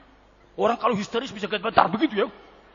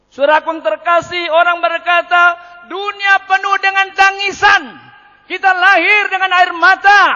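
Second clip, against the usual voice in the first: loud, high, slow oratory; full-force preaching; 13 semitones higher, 12 dB louder than usual. Real, not acted. Preacher addressing the congregation on suffering, full-force proclamation. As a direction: proclaim it, stretching each phrase.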